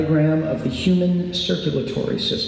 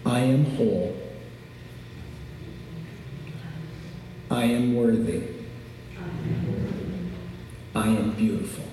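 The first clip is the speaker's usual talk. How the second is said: calm grounding exercise; 3 semitones lower, 5 dB quieter than usual. Shown as a demonstration. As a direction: guide them gently through breathing. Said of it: "end with a little exercise".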